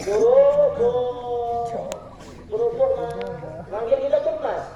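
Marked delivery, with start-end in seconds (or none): 0.1-3.1 long drawn-out street seller call; vendor's call. Retold or imitated. Preacher imitating a cracker seller calling out. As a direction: stretch it like a hawker calling door to door.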